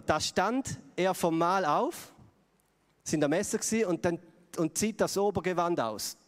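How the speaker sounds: slow, emphatic reading of the verse; Scripture reading. Acted. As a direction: lay each word down slowly. Reads the next verse. (langsame, betonte Bibellesung)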